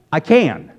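Southern "can" split into two syllables, ca-yun. Shown as a demonstration. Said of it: "you can turn one-syllable words into two-syllable words".

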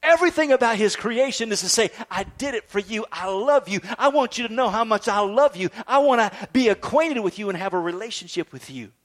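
high, forceful sermon delivery; impassioned preaching. Acted. Preacher voicing God's love to the congregation, impassioned. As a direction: preach it with swelling fervor.

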